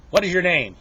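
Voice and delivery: loud, high demand for a name; stern teacher. Acted. Teacher repeats question, correcting pupil.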